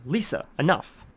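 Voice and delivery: loud sharp shout to stop her. A narrator's reading. Therapist stopping his patient hitting herself, shouting. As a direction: yell to make her stop. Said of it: "Theodore shouting".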